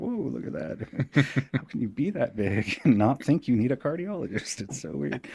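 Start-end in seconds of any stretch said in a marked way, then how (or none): 0.0-2.4 mock gawking. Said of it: "it's like".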